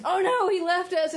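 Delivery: high-pitched imitation of helpless panic; hammed-up dismay, pitched well up; mock panic. Knights abandoned by their leader, high mock panic.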